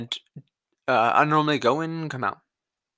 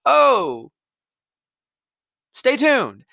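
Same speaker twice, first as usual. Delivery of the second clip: mock dismissive state voice; 9 semitones higher, 4 dB louder, much slower than usual. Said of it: "state answers the follower".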